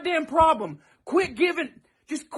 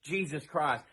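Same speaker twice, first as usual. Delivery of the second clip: ordinary talk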